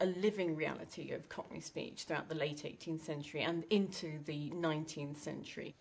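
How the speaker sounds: ordinary talk, nothing marked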